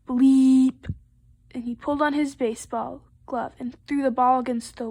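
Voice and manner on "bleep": one loud, held horn note; storybook honking effect; saxophone blast. A narrator's reading. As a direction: blare the word out long.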